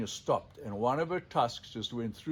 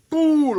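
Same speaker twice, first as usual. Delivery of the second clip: loud higher "boo" imitating a water jet bursting out; spout noise; 14 semitones higher, 12 dB louder than usual.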